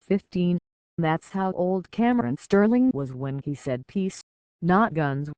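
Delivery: intense rhythmic slam delivery with a punched refrain; fervent recital. Acted. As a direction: recite it with fervour to the crowd. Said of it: "recited fervently".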